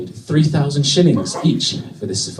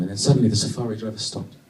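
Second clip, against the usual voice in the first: ordinary talk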